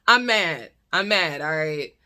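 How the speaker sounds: ordinary talk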